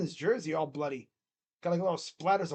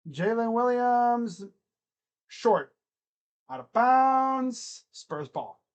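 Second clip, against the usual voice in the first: slow, stretched announcer call; sustained sportscaster delivery, words held long; play-by-play commentary; 2 semitones higher, 3 dB louder, slower than usual. Acted. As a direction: draw out each call like a commentator. Live play-by-play.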